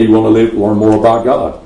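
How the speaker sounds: ordinary talk, nothing marked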